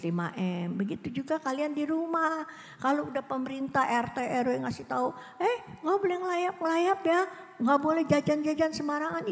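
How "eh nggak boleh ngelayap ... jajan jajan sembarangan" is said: lightly voiced local official, higher; a hint of a stern neighbourhood chief; warning neighbours. (peringatan ketua RT yang sedikit ditirukan)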